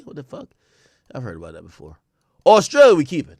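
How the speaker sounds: usual speaking voice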